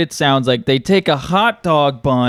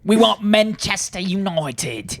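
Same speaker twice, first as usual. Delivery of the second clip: higher-pitched British football fan; hammed-up British demand; mock British accent; 4 semitones higher, 4 dB quieter than usual. British fan demanding Manchester United, mock British accent. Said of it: "I like the British act".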